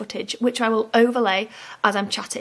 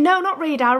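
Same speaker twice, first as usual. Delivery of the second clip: imitating child; 5 semitones higher than usual. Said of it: "he was saying".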